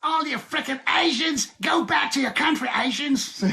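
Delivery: high-pitched mock Australian shout; imitated shouting. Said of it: "one person was shouting at me".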